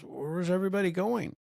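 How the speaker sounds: put-on little son asking his dad, light kid voice; son's voice. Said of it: "he goes".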